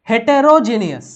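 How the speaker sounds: ordinary talk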